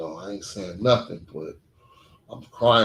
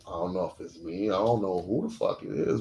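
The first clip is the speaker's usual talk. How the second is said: ordinary talk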